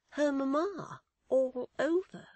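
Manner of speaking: ordinary delivery, nothing marked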